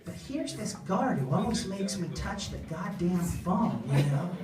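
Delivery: ordinary talk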